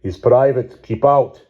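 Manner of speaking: put-on heavy Russian accent, loud and curt. A narrator's reading. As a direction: bark it in thick Russian English.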